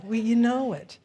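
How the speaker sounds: usual speaking voice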